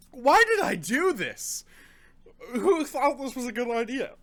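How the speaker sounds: high, agonized lament; pained whine. Real, not acted. Reviewer regretting the habanero dare, high pained whine. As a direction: wail the regret, pitched high.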